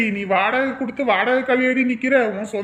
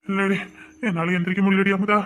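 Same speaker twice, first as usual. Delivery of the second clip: feverish weak voice; 5 semitones lower than usual. Acted. Feverish husband scolding wife from bed, low and weak. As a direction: groan it out from the sickbed. Sick husband can't get up.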